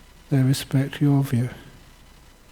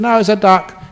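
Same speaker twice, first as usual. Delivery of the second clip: higher and louder, a firm no; insistent wife; 7 semitones higher, 6 dB louder than usual. Acted. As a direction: insist hard that it's a duck. Acted couple's quarrel.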